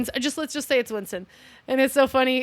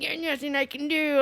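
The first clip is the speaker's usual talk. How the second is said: mock-angry higher-pitched outburst; pissed off; 5 semitones higher, 3 dB quieter than usual. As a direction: imitate someone pissed off in a put-on voice. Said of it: "He's all pissed".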